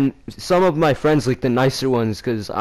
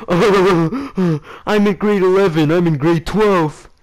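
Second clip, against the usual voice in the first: high, slow jeering imitation; hammed-up taunting voice, drawn out; laughing mockery; 7 semitones higher, 4 dB louder, slower than usual. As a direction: mimic girls making fun of a kid. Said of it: "they were laughing like".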